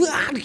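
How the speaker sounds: loud, high smackdown yell; imitated wrestler's attack shout; mock wrestling roar. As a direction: burst out a hammed-up slamming cry. Acts out a wrestling slam.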